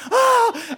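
sudden high shriek; startled scream. Retold or imitated. Mother startled at the stove with a knife, high shriek.

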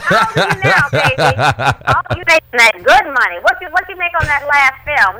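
lightly voiced auntie at a reunion; prying family member, teasing; nosy relative voice. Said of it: "Those sound like family reunion questions".

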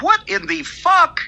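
high, slow, drawn-out what in the fuck; stunned disbelief. Retold or imitated. Waking to Twitter chaos, high stunned disbelief. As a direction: stretch it out, pitch way up in shock. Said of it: "I'm like".

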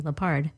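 "Leppard" as luh-PARD; professor's pronunciation. Retold or imitated. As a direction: stress the end of "Leppard", rhyming it with hard. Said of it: "That's how he pronounced it".

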